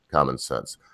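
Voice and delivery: ordinary talk, nothing marked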